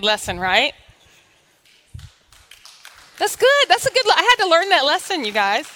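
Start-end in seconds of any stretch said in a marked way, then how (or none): 3.2-4.7 very high, loud delighted exclaim; excited praise. Real, not acted. Preacher delighted with her lesson to the church, high and loud. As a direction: cheer your own point.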